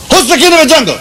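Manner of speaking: high, loud lion roar of a question; hammed-up nasty lion challenge. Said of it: "the lion was very nasty".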